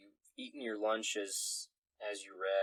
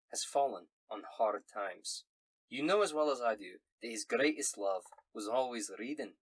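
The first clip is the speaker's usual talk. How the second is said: solemn Scottish letter-writer; Scottish accent. Acted. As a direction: break sad news in a Scots brogue. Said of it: "in a Scottish accent".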